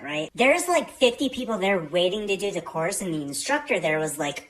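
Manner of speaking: usual speaking voice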